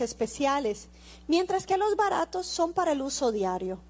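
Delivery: loud fervent preaching; preaching at full force. Acted. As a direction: preach it out to the congregation. Predica con fuerza. (predicación a plena voz)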